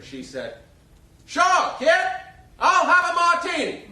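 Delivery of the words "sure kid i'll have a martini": much higher and louder, a big Broadway belt. Retold or imitated. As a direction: boom it out so the second balcony hears. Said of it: "in that booming voice you could hear in the second balcony".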